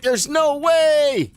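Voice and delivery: high, slow, drawn-out cry of disbelief; can't believe the boss showed up; stunned disbelief. Real, not acted. Streamer to chat as the boss arrives, drawn-out high disbelief. Boss Ripper Madness appears.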